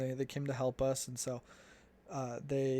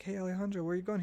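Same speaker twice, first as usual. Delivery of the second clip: calling out; 6 semitones higher, faster than usual. Retold or imitated. Missionaries calling from truck.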